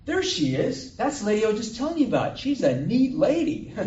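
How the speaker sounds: loud, high-pitched announcement; acted excited holler, high and loud; excited hailing. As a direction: call out delightedly to everyone nearby. Shop owner hails her to others.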